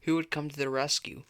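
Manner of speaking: usual speaking voice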